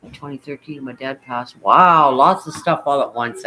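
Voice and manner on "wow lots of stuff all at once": shocked. Real, not acted. Hearing about stacked losses.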